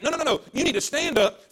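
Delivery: usual speaking voice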